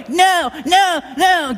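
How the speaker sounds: high mock child's shout; child shouting no while running away; toddler refusing.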